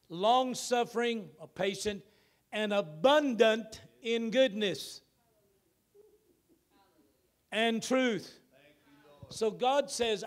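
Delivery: loud, high preaching with drawn-out words; full-force preaching. Acted. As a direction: proclaim it to the whole church. Preacher reading scripture at full force.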